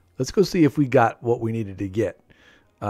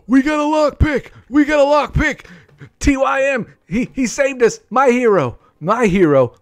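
thrilled shout; 11 semitones higher, 7 dB louder than usual. Real, not acted. Rescued from jail by a teammate.

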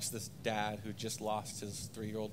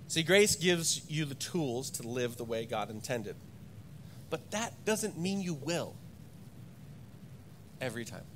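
ordinary delivery

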